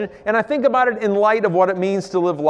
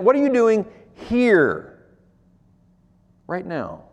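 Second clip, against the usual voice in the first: slow, higher, pressing each word; searching question; 4 semitones higher, slower than usual. Acted. God's pointed question.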